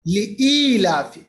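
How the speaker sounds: لإیلاف slowly, second vowel held long; tajweed demonstration. Shown as a demonstration. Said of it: "قواعد پڑھیں گے ہم". (لإیلاف مد کے ساتھ کھینچ کر)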